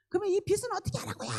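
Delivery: high, loud, rapid complaint; angry protest. Retold or imitated. Arguing with God over the debt, angry outburst. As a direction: throw the complaint back at God, acted out. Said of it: "또 이렇게 두 번".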